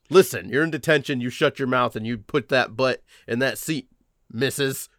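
ordinary talk, nothing marked

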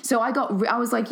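normal talking voice

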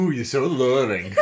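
high, loud purr through laughter; sultry purring voice. Shown as a demonstration. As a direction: purr it seductively while laughing. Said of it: "would be like a breathy roll".

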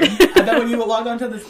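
loud laughing. Real, not acted. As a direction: laugh through the words loudly. Laughing loudly.

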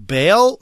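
"Bail" as a high, loud, drawn-out question; "Bail" swooping up, unsure; child guessing. Retold or imitated. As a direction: guess "Bail" like a hopeful new reader. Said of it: "she's like".

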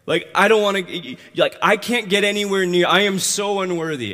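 loud, high, urgent self-reproach; distraught protest. Acted. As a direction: act out a sinner recoiling as unworthy. Peter protests that he is unworthy.

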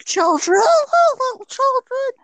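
put-on high, eerie sing-song calling of children; creepy falsetto call. Creepy stranger calling to little children in a high croon. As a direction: lure them in with a spooky high croon. Creepy man luring kids.

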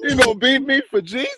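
mock indignant protest. Child challenging a whooping after church, mock indignant cry. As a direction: squeal it in hammed-up disbelief.

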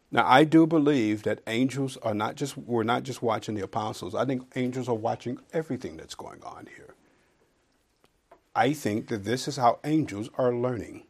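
ordinary talk

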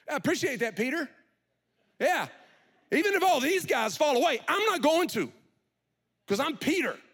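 loud, high, smug brag; smug mock boast; mock bragging. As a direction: puff up and brag, mockingly. Mocking Peter's boast.

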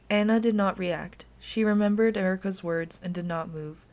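ordinary delivery, nothing marked

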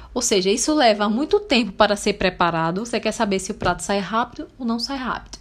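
usual speaking voice

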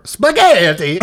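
loud, hammed-up Italian accent; cartoon Italian accent, played for laughs; mock Italian accent. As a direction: burst out in a fake Italian accent. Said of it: "if that's an Italian accent".

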